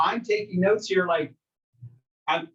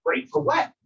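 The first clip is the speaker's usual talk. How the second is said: skeptical client; 9 semitones higher than usual. Retold or imitated. Client retort to glib answer.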